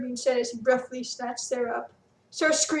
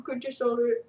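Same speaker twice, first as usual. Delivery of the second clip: plain reading voice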